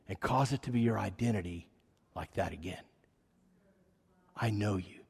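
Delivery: soft, near-whispered reassurance; tender voice of God. Retold or imitated. God reassuring his son, a soft whisper. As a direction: hush it tenderly, like a father to a son. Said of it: "And the Lord said".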